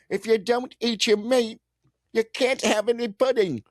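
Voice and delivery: put-on very high, piping English-accent scolding; high-pitched cartoonish British telling-off; mock British scold. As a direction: squeal it in a silly British voice. Joking mock-British voice about pudding.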